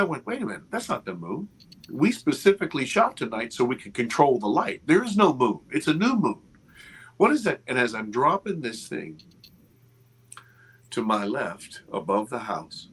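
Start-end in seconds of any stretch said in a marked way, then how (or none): 0.3-7.6 rising alarm. Retold or imitated. Realising the light is not the moon, rising alarm. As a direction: pitch climbing higher as disbelief grows.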